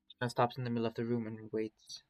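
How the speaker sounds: ordinary delivery, nothing marked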